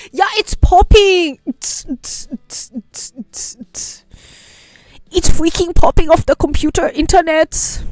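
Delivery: loud, high hyped-up chatter; excited. Real, not acted. Streamer hyping the Eurosleep genre, loud and high. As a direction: pump it up like the music is banging.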